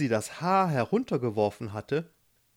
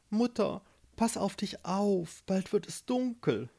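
put-on mildly anxious, higher-pitched girl; ein bisschen ängstlich; 7 semitones higher, 3 dB quieter than usual. A narrator's reading. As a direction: show a touch of worry for mother. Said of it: "sprach ein bisschen ängstlich". (leicht ängstlich, etwas höher)

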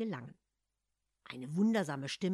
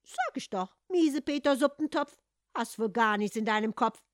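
cheeky taunt; 7 semitones higher, 8 dB louder than usual. Acted. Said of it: "beeilte sich der Kater zu sagen".

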